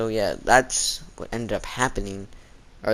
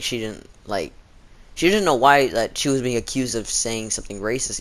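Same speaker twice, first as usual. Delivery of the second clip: ordinary talk, nothing marked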